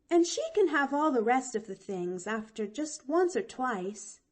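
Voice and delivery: put-on high, eager little girl; young girl voice. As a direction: chirp it out like a bright chatty child. Pollyanna explains eagerly.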